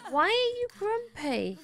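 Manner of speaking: very high, drawn-out question; exasperated questioning. Real, not acted. Player questioning a grumpy game character, high-pitched exasperation. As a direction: ask it way up high, stretching the words.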